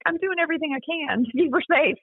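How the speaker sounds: retold pleading self-defence; defensive protest. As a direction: protest that you're trying, pitch jumping up. Defensive reply to the caseworker.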